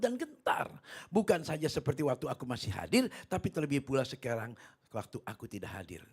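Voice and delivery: scripture declamation. Real, not acted. Preacher reads Filipi to the congregation, emphatic declamation. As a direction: proclaim the verse with weight and stretched key words.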